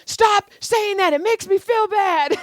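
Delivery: high, drawn-out imitated protest; mock whining protest. Guilty friend begging her to stop, in mock protest. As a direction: plead in a high put-on voice.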